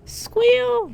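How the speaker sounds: high loud squeal with a fall; pig squeal. Acted. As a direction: squeal in delight. Client enjoying humiliation.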